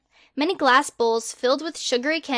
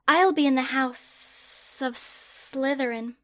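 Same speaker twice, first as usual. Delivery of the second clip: put-on creepy snake boy; 2 semitones higher, much slower than usual. Slytherin boy slowly pitching his snake survey, slow and eerie. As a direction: draw each word out like a creeping snake.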